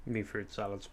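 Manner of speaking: talking with a mouth full. Real, not acted. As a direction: speak round a mouthful of sweets. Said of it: "I'm talking with my mouth full now".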